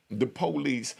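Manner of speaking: usual speaking voice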